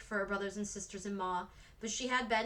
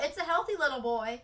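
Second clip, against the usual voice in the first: a joyful call, higher and louder; shout; 5 semitones higher, 5 dB louder than usual. A narrator's reading. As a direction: call the news through the closed door. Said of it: "till they heard Nelda shout".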